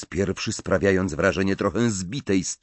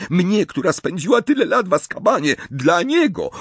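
much higher, fast and fervent; passionate high cry; 12 semitones higher, 5 dB louder, faster than usual. Acted. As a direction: insist fiercely on her sacrifice. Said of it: "zawołała z pasją Bellatrix".

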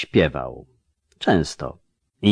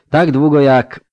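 high, louder, abruptly choked off; voice catching; 7 semitones higher, 7 dB louder than usual. A narrator's reading. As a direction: rise in distress and break off suddenly. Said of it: "Głos uwiązł jej w krtani tak raptownie".

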